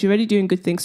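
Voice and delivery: ordinary delivery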